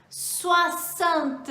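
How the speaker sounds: "Soixante" said "swa-sant", clearly and carefully; French pronunciation demo. Shown as a demonstration. Teacher shows learners the tricky French sixty. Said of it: "a pronúncia é uma coisinha bem diferente".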